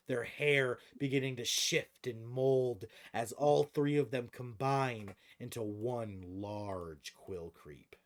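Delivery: game master narration. Acted. Game master describing creatures fusing for the players, dramatic narration. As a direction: narrate the monster's transformation, drawing out key words.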